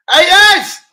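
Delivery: sudden high shout; loud mock-furious yell at haters. Real, not acted. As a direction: scream it at the haters.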